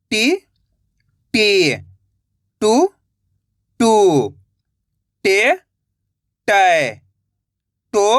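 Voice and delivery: each syllable said alone, slow and clear, with a pause after it; syllable drill. Shown as a demonstration. Teacher drilling students on the barakhadi, clear syllable recitation. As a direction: recite syllables for the class to repeat. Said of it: "जिस तरीके से मैं एक एक अक्षर का उच्चारण कर रहा हूँ".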